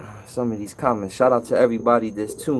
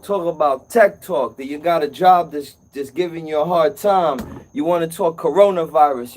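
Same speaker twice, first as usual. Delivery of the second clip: upbeat call-in show host, a bit louder and higher; radio host plug; 3 semitones higher, 3 dB louder than usual. Acted. Host urging viewers to phone in, upbeat radio plug. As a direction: sell the phone line to the audience like a DJ.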